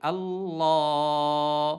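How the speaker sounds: আল্লাহ with a thin, light ল, stretched very slowly; slow demo of আল্লাহ with a thin ল. Shown as a demonstration. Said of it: "এই জন্য ল হবে".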